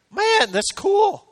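high, drawn-out wonder; excited marvel. Real, not acted. Pastor marveling to the church, high and slow. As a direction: let it burst out in amazement.

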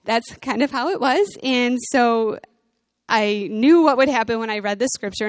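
ordinary delivery

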